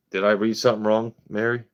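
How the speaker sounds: ordinary talk, nothing marked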